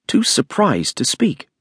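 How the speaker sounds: usual speaking voice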